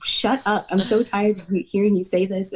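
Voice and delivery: mock him snapping at her; a hint of an exasperated friend snapping; retold snap. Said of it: "he snapped at me".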